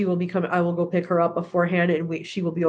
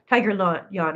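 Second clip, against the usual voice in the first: ordinary talk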